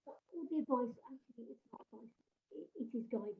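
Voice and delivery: muffled, as if mic covered. Real, not acted. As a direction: speak through a covered mic. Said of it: "that was very muffled".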